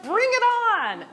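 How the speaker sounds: loud, high, quick cheer; hammed-up egging-on shout; rallying cry. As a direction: holler it as playful encouragement. Group cheers on crying newcomer.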